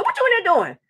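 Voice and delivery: high mock-irritated demand. RA at the door demanding an answer, irritated. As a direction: press him for an answer. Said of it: "I was really irritated".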